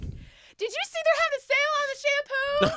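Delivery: high, bright fake-cheerful change of subject; cheery diversion. Daughter distracting her angry mom, fake cheerful. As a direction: chirp it to distract. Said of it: "And I'm like".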